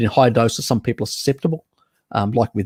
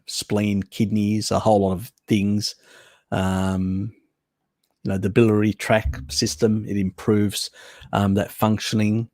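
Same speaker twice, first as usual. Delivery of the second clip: usual speaking voice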